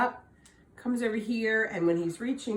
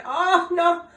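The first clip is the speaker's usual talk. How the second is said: high, loud whiny plea; whining leprechaun; 13 semitones higher, 8 dB louder than usual. Acted. Caught leprechaun whining to Tom to let him go. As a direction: whine and wheedle for mercy. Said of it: "wiggled and twisted and whined".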